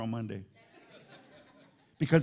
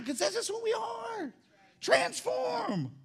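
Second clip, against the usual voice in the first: very high, drawn-out pulpit cry; straining preacher's cry; much slower than usual. Real, not acted. Preacher driving the point home, high strained cry. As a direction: stretch it out high without extra volume.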